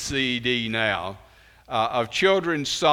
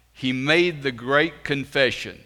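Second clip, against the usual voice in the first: ordinary talk, nothing marked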